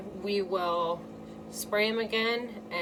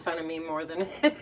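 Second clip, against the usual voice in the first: usual speaking voice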